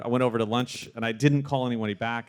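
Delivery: ordinary talk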